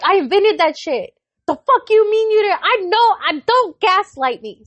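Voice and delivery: high, loud retold comeback; heated mock tirade at a kid; outraged mom. Retold argument with son.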